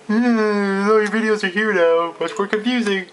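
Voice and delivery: high put-on voice, held throughout; mock the website in a silly high voice. Mocks a confusing YouTube page.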